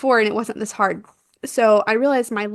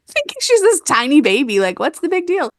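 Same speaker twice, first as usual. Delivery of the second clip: laughing; 6 semitones higher, 4 dB louder than usual. Real, not acted. Laughing at her own assumption.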